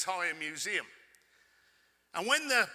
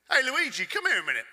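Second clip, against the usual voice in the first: calling out across room; 6 semitones higher, 7 dB louder than usual. Retold or imitated. Calling the curator over loudly.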